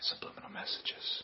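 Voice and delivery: conspiratorial whisper. Pretend. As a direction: whisper like a hidden message. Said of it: "Why are we whispering?".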